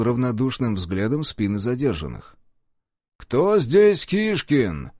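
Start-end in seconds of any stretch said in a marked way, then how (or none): 3.2-4.9 slow, high call across a crowded corridor. A narrator's reading. Officer calling out to the detainees, slow and loud. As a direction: draw out the question, summoning. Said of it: "позвал Мышанский".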